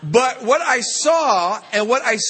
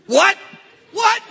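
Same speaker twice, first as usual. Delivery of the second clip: high, loud incredulous what; shocked disbelief; 13 semitones higher, 4 dB louder than usual. Retold or imitated. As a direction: yelp it in disbelief. Disbelief at nephew's claim.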